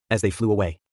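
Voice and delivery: plain reading voice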